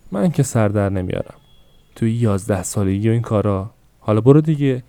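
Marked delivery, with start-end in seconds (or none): 4.0-4.8 higher and louder, sending her off; a mother shooing her daughter out; impatient shooing. Acted.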